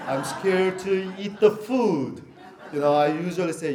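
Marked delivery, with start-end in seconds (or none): 0.1-2.2 slow, laughing through the words. Real, not acted. Pastor joking about his wife's cooking, slow and laughing. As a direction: chuckle out a mock confession.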